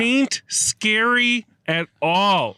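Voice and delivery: slow, drawn-out folksy twang; folksy drawl. Pretend. Repeated, drawn out higher.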